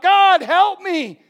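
loud, high, drawn-out plea; crying out. Acted. Believer overcome by life calls on God, crying out. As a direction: cry it out slowly at full voice. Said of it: "the ability to cry out".

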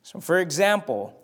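ordinary talk, nothing marked

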